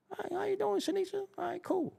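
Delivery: high, sweet, soft hello; lightly voiced overly nice guy; high polite greeting.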